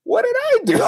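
high squeaky disbelief breaking into a laugh; bewildered. Retold or imitated. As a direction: play the wronged innocent, voice jumping way up. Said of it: "And I'm like".

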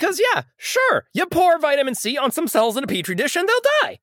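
high-pitched sarcastic enthusiasm; mock agreement. Host mocking petri-dish science, in high sarcastic agreement.

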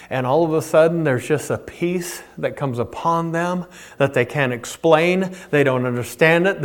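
hushed, tender preacher's voice; soft preaching. Acted. Pastor speaking softly to grieving listeners, hushed and tender. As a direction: draw them in quietly.

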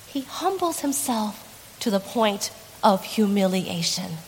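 usual speaking voice

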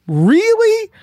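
high-pitched disbelieving question; incredulous. Real, not acted. Speaker reacts to the fake-news claim, incredulous.

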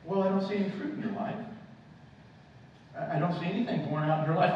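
normal talking voice

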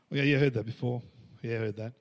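low dismissive murmur, dropping away; jaded, over-familiar. Acted. Churchgoer who has heard the verse before, jaded dismissal. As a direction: shrug it off as old news.

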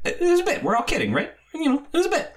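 high-pitched mock reassurance; brushing it off. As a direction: play it off in a high voice. Acts own dismissive backtrack.

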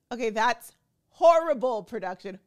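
loud, indignant outburst at production; outraged. Real, not acted. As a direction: call it out, appalled.

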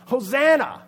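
high, full-voiced crowd shout; acted rallying cry; yelling out. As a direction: yell it across a crowd. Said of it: "He yells out".

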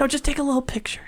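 quick urgent whisper. Real, not acted. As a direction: whisper fast so he stays still. Quietly photographing the pet.

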